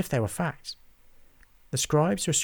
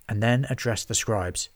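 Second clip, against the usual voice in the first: plain reading voice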